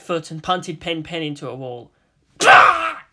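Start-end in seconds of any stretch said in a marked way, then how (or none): 2.4-3.0 high, loud screeching squawk; shrill penguin squawk. Acted. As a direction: screech like a kicked bird. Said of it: "The penguin screeched".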